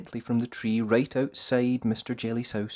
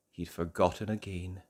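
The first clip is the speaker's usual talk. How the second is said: ordinary delivery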